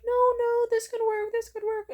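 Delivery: very high, loud mock pleading; mocking imitation. Friend mocking his girlfriend's pleas, mocking high-pitched wail. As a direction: squeal it out as a mocking impression of a desperate girlfriend. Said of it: "he started mocking her".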